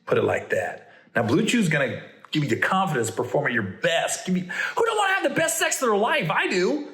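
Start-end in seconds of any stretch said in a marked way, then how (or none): 4.3-6.3 laughing shout. Acted. Host hyping the sponsor to his podcast listeners, laughing shout. 6.3-6.9 excited. Acted. Host hyping the sponsor.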